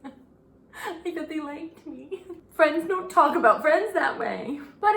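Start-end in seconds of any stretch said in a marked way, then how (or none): 0.0-2.2 softer, slow, laughing fake whimper; mock crying shark. Voicing the frilled shark at home crying, mock sob. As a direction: play the sulking shark, wounded.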